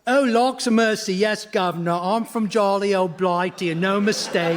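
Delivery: hammed-up fake English accent; ridiculous English accent. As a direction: pose as an Englishman with an absurd accent. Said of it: "that ridiculous accent".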